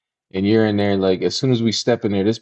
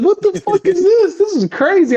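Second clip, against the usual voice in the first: very high what the fuck; squeaky disbelief; 4 dB louder than usual. Retold or imitated. Recalling the begging girl, squealing disbelief. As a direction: shriek it in disbelief.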